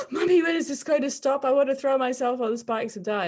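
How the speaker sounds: put-on high, loud anguished child's plea; despairing child. Mother voicing her sick son begging for it to stop, high and anguished. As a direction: plead like a miserable six-year-old at the end of his rope. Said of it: "head in his hands going".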